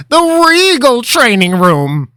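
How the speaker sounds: high, loud, grand announcement; grand showman. Acted. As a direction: present it like a ringmaster. Linram's grand flourish.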